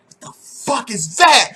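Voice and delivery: high, slow, horrified question; appalled what. Retold or imitated. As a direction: recoil at something grotesque. Imagining a horrifying sight.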